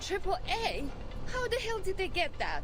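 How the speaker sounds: high, alarmed disbelief; shocked. Acted. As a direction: blurt it out in shock. Shocked at anti-aircraft fire.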